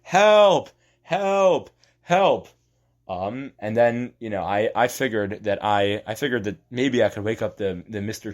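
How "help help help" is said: high, loud desperate cries; yelling for help. Retold or imitated. Stuck in boiling cheese, calling out loud for help. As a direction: shout for help, stuck in the goop. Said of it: "so I started yelling".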